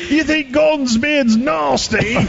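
slow, drawn-out bad British accent; terrible fake British accent. Host retelling his own bad accent on the street. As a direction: ham up a poor British accent. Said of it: "mine was terrible".

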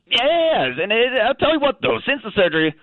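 casual Australian talking dog; chatty Aussie caller, thick Australian accent. Acted.

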